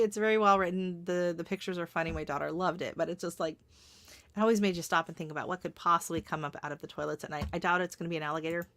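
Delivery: usual speaking voice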